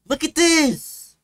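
loud, high, drawn-out exasperated shout; angry yell. Real, not acted. Streamer showing viewers what annoys them, angry yell. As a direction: yell it slowly in outrage. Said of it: "I'm getting angry".

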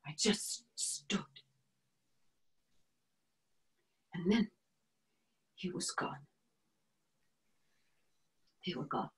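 near-whisper with long pauses; stunned hush. Acted. Woman left behind after the arrests, stunned hush. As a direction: let the words out very softly, barely voiced.

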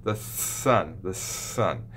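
sun said with a clear tongue-down s. Shown as a demonstration. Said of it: "It's more like this".

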